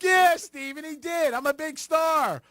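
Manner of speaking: high, slow playful shout at the host; mock heckle yell. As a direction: call it out from the seats. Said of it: "I'll be like".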